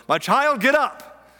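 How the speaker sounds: loud, high-pitched wake-up call; parent waking a child. Acted. Parent getting kids up for school, loud call. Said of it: "telling your kids to get out of bed".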